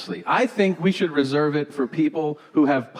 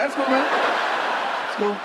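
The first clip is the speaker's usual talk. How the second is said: forced politeness; 6 semitones higher than usual. Retold or imitated. Awkwardly humoring the guard.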